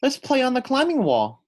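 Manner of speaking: put-on high, loud, excited child's call; excited little girl voice; eager kid. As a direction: bounce it out like a kid itching to climb. Maddie eager to climb.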